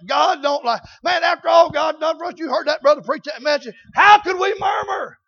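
loud, rising preacher's exhortation; shouting preacher calling the church to work; full-force preaching. Acted. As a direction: drive it home to the congregation.

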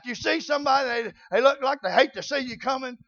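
ordinary talk, nothing marked

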